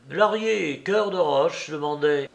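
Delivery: plain reading voice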